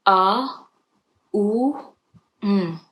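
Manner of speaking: A, U, M sounded out one by one; teaching the syllables A, U, M; Om split into syllables. Shown as a demonstration. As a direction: spell out the three syllables of Om, each on its own. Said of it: "break it down into three syllables".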